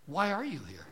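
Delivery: a doorkeeper losing patience; exasperated Peter. Acted. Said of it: "Saint Peter, in this imagined story, says".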